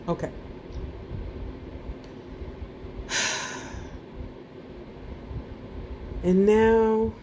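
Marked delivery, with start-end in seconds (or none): 2.3-4.0 long, soft, voiceless exhale; long sigh. Shown as a demonstration. Finishing the breathing exercise with listeners, long soft sigh. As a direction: let the breath all out in a sigh.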